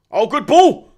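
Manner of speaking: loud, high cheer; excited. Real, not acted. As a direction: cheer the pass. Reacting live to match.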